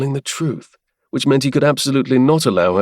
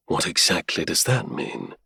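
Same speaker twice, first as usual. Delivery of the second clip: low, much softer grim murmur, voiced; a quiet menacing question under the breath; grim whisper; 4 semitones lower, 6 dB quieter than usual. A narrator's reading. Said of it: "he asked on a grim whisper".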